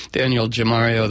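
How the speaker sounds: ordinary delivery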